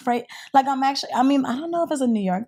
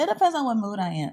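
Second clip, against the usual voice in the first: ordinary talk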